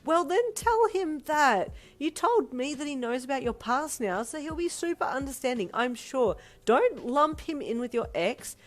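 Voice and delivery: high, loud, emphatic lecture; preaching. A narrator's reading. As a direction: preach sense into a sibling. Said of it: "her sister preached".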